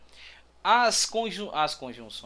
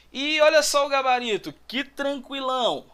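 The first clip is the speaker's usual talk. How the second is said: animada; 7 semitones higher, 5 dB louder than usual. Real, not acted. Professora comemora alternativa fácil.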